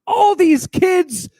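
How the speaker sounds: stunned and thrilled, voice jumping up; amazed. Real, not acted. Amazed praise for the video.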